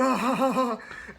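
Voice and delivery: high, loud burst of laughter; hearty laugh. Retold or imitated. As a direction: laugh big and open. Drax's laugh acted out.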